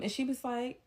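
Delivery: usual speaking voice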